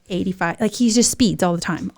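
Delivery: ordinary delivery, nothing marked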